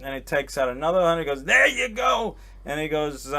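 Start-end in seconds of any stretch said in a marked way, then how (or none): 1.4-2.3 high, loud exclamation; imitated old-school New Yorker, loud and high; mock generous owner. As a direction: hand it over with a big flourish. Acting out owner handing over cash.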